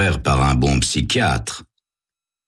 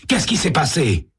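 alarmed question; 10 semitones higher than usual. Acted. Sam repeats question, rising panic.

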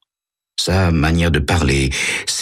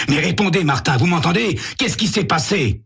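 urgent pleading; 11 semitones higher than usual. Acted. Sam shakes dazed Martin.

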